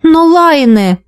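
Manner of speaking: high, falling note of confusion; bewildered protest. A narrator's reading. Трис hears her friend is leaving, bewildered protest. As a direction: protest as if at a loss. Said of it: "растерянно пробормотала Трис".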